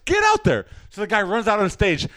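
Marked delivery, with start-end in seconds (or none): 0.1-0.7 high, acted urgent order; urgent hurrying. Stage hand sends the actor on, urgent. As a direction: push him onstage with a raised voice.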